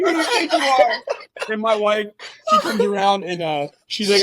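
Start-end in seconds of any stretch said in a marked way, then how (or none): 0.0-0.9 high, loud and laughing, scolding a hose; hammed-up indignant yell at a garden hose; mock outrage. Husband telling the podcast how he scolded the hose that hit his mouth, with a loud laughing mock outrage.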